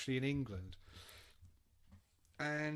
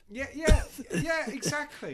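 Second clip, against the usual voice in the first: much higher and louder yeah, yeah; excited agreement; 11 semitones higher, 11 dB louder than usual. Real, not acted. Answering his cohost's Sweet Leaf joke, excited. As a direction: burst out agreeing. Laughing agreement about coughing.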